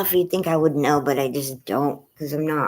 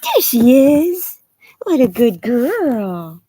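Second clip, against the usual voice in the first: pet praise; 7 semitones higher, 10 dB louder, much slower than usual. Real, not acted. Owner gushing praise at her dog. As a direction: gush over the dog.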